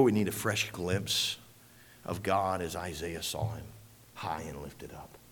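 soft, dropping to a whisper; hushed reverence. Acted. Preacher hushing the church in awe of God, soft whisper. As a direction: breathe it out in awe.

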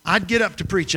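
usual speaking voice

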